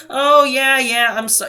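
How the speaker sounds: high, loud put-on sympathy; mock apology. Retelling the supervisor's excuse to a customer, mock apologetic. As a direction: imitate an apology mockingly.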